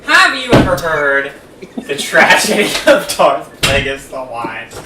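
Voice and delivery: slow, drawn-out dramatic recital; theatrical villain monologue. Acted. Co-host reciting a film monologue to friend, slow and theatrical. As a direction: intone it slowly, like a portentous storyteller.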